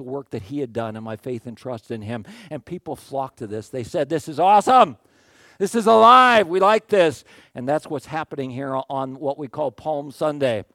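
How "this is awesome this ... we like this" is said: loud, high, thrilled crowd voice; excited crowd. Retold or imitated. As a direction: cheer it like an eager crowd. Crowd excited about Jesus.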